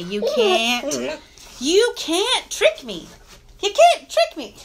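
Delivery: high, drawn-out teasing of a pet; playful pet talk. Real, not acted. Owner telling the dog he can't trick her, playful.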